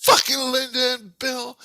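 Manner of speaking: high, loud retold shout; shouting daughter. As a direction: yell it up high as the girl. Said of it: "And she goes".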